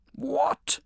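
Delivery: high, loud burst of shock and rage; lion roaring in disbelief; roar of outrage. A narrator's reading. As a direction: explode at the news. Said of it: "roared the lion".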